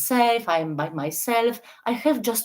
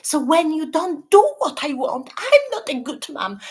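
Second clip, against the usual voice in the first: hint of a bossy, guilt-tripping mother; role-playing a controlling mom; 5 semitones higher than usual. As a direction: play the mom who only loves when obeyed. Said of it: "I, I'm your mom, I'm the abuser".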